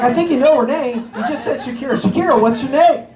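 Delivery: high, laughing teasing reply; laughing mock retort. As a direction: tease him through laughter. Mocking the singer, laughing.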